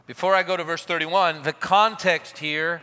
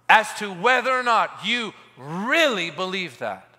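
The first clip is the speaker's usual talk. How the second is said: raised, ringing pulpit voice; fervent preaching; 8 semitones higher, 5 dB louder, slower than usual. Acted. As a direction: preach it out to the crowd. Preaching at full force.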